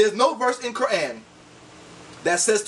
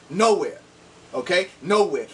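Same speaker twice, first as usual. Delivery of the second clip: ordinary talk, nothing marked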